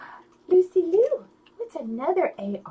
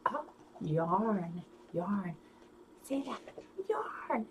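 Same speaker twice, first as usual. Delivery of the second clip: quiet, gentle word drill; soft spoken; 6 semitones lower, 14 dB quieter, much slower than usual. Shown as a demonstration. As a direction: murmur the word softly to the kids.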